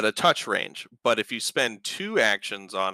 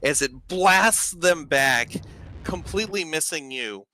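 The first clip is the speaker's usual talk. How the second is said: raised, slowed storytelling voice; game master narration; 5 semitones higher than usual. Acted. Game master describes the battle to players, in vivid narration. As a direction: paint the scene for the table.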